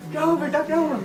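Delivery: light imitation of an anxious mom; worried mother. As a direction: imitate a worried mother checking on her son. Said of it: "mummy परेशान होके आ रही है".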